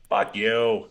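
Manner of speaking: put-on high thick Boston accent Fuck you; Boston accent yell. Cornered robber yelling at detective in thick Boston accent. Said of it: "screams out in a very, like, faint but, like, really thick, like, Boston accent".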